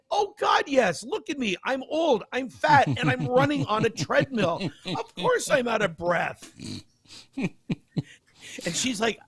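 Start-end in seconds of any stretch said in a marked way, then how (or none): 0.1-6.5 high-pitched, hammed-up indignant protest; comic exasperation. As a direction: act out being fed up with an obvious question. Exasperated answer to nurse, retold.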